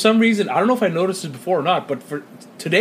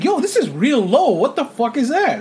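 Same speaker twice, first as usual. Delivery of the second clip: retold amazement; 7 semitones higher than usual. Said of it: "I was like".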